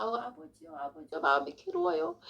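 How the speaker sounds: quiet, sighing plea to God; soft pained prayer. Retold or imitated. Back at her seat, praying softly in distress. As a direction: murmur the complaint to the Father.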